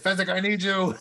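high, urgent mock cry for help; calling out. Imitating Inigo calling Fezzik to the locked door, high cry. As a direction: call it out high to a friend.

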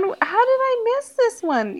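high, slow, amazed question; excited disbelief. Real, not acted. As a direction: stretch it out in disbelief.

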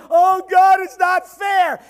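loud mock complaint; mock whining. Believer complaining to God, a loud mock whine. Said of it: "Don't come to God with all your".

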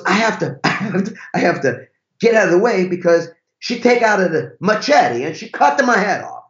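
high, hammed-up Italian husband in peril; awful Italian accent. Driver dreads his wife's temper, awful Italian accent. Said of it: "That's an awful Italian accent".

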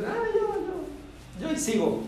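hammed-up high feeble bluff; mock squeak. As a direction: stretch it out high like a clueless kid. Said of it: "pero tú dices".

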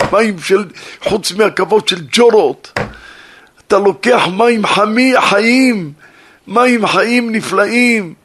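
loud, high preaching of the verse; fiery preaching. Acted. Preacher rebukes the listeners for leaving living water, loud fiery preaching. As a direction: thunder the scripture at the crowd. Preacher quotes scripture at full force.

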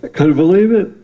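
high, loud amazed quote. Retold or imitated. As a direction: gush like a stunned fan. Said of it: "he's like".

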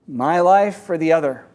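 ordinary delivery, nothing marked

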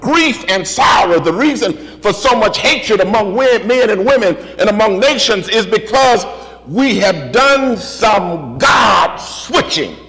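emphatic sermon cadence, list rolling forward; rhythmic preaching. Real, not acted. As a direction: preach it in driving, repeated phrases.

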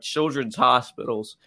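usual speaking voice

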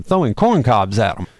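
usual speaking voice

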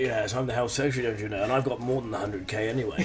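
low, soft put-on politician voice; Matt Hancock. Podcaster playing the health secretary on a dating show, mock-official. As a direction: play a smug minister, quietly. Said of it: "Matt Hancock would be terrible at this".

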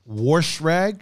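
"Wash rag?" with an R in wash, rising; echoed "worsh rag". Retold or imitated. Checking the grandfather's word, questioning added-R pronunciation.

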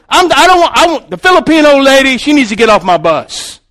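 loud, high-pitched, stumbling complaint; bothered bus driver. Retold or imitated. Bus driver complains to boss about a passenger, loud and agitated. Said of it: "bus driver got a little bit bothered".

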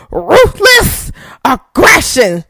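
mock battle shout, each word apart; hammed-up catchphrase. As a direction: belt out a wrestling promo catchphrase. Imitates wrestler's old catchphrase.

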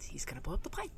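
low, quiet private dread; hushed retold panic. Passenger telling herself about the danger, hushed dread. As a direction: murmur the fear low, under your breath.

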